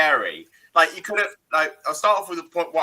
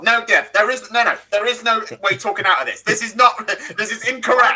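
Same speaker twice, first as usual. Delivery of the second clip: higher, louder indignant protest; nerdy outrage, insistent; heated objection; 4 semitones higher, 4 dB louder than usual. Real, not acted. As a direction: shut the argument down, worked up. Heated objection to co-host's defence.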